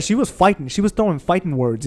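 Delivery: ordinary delivery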